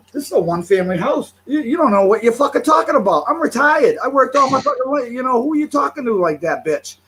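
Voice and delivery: indignant street argument voice; retold angry rant. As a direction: replay the argument like it's happening again, fast and loud. Retold shouting match with parked driver.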